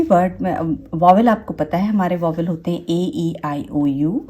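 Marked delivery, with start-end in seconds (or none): none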